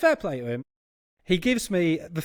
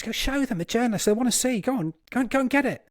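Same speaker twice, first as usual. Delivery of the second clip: pitched much higher, pushing the manager on; keen, high insistence to show it off; eager urging; 8 semitones higher than usual. Retold or imitated.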